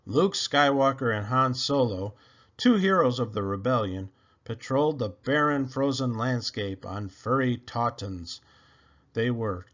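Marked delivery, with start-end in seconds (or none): none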